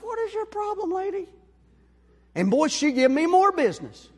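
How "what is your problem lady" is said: high, incredulous challenge to the lady; snapping at her. Retold or imitated. To the lady in the truck, a high incredulous snap. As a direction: toss it up sharp and high, daring her. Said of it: "I'm like".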